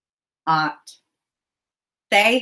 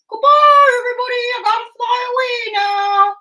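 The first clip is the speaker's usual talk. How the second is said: high excited puppet bird, singsong; Ollie puppet voice; 13 semitones higher, 3 dB louder than usual. Acted. Puppet bird cheering the kids on, high and excited. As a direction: chirp the praise like a thrilled puppet.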